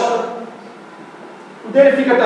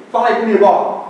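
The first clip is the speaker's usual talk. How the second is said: ordinary talk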